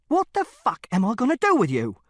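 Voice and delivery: loud, high, angry outburst; father at the end of his tether, shouting; furious exasperation. A narrator's reading. Father's question escalates.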